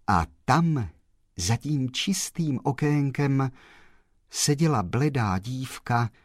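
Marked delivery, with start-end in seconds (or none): none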